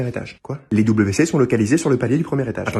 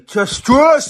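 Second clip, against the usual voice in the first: shout; 11 semitones higher, 7 dB louder, much slower than usual. Acted. Chasing someone out.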